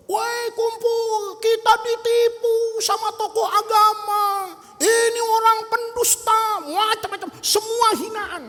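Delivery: high, drawn-out public call; crying out. Acted. Student denouncing his teacher to the crowd, crying out. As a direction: call out slowly on a high pitch. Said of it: "Dia teriak".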